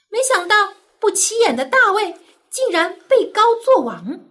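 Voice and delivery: usual speaking voice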